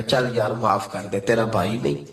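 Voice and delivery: put-on hushed, humble plea to an older brother; quiet plea; soft pleading. Younger brother begs elder's forgiveness.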